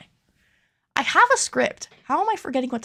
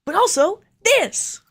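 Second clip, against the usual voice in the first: much higher, punchy "this"; cringey overexcited host voice; over-the-top reveal; 11 semitones higher, 8 dB louder, slower than usual. Acted. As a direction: hype up the big reveal. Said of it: "why am I talking like that?".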